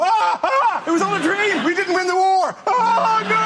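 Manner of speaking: high, loud hammed-up panic; high mock terror. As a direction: wake up in a comic panic, pitched right up. Panicked waking from a dream.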